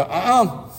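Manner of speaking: high mock-scolding uh-uh; tut-tut like a mild parent catching a bad thought; a hint of a stern mother's no-no; disapproving parent. Parents scolding little Jesus.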